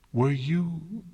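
high, choked and strangled. A narrator's reading. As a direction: force the words out through a tight throat. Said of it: "in a strangled voice".

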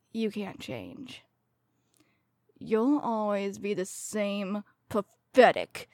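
ordinary talk

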